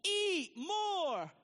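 high, loud slogan call; mock call-out. Joking about Chick-fil-A slogans, loud and high. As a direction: sing out the slogan like a customer. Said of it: "we were to say".

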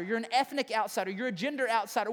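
ordinary delivery

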